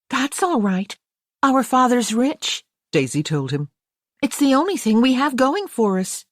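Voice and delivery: put-on high, bright American girl laughing at herself; cheerful girl. A narrator's reading. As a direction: play it gleeful and cheeky. Daisy voiced again.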